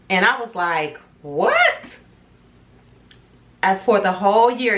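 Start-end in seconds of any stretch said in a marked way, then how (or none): 1.2-2.0 high, stunned rising what; shocked what. Retold or imitated. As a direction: react like you just heard he's married. Shocked reaction in retold story.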